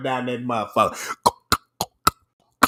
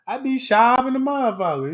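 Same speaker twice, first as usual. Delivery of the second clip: ordinary delivery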